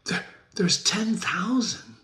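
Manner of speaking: servant's hushed whisper; frightened whisper. Acted. Servant whispers fearfully to the furious Macbeth. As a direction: whisper it, scared of the king.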